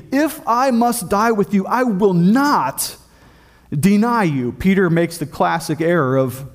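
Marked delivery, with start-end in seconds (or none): 0.1-4.5 high, insistent vow with NOT drawn out long; emphatic. Retold or imitated. Peter insists to Jesus he won't deny him, emphatic. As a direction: raise the pitch, stretch NOT, pause before deny. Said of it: "he said to them emphatically".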